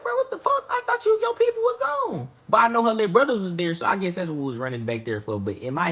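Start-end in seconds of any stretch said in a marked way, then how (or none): 0.0-2.3 high, panicked squeal of a scared virgin. Retold or imitated. Teen boy sneaking into girl's house, panicked high squeal. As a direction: shriek it out in pure panic, much higher. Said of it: "scared as fuck".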